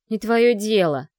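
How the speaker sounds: loud, slow, spiteful hiss. A narrator's reading. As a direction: spit it out in spite. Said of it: "прошипела Ада".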